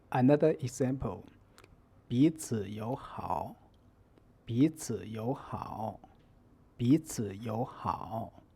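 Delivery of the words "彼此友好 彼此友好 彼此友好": slow, deliberate 彼此友好 for learners to copy; repeat-after-me tone model. Shown as a demonstration. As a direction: say 彼此友好 slowly, each syllable's tone drawn out. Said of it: "Listen carefully and repeat after me".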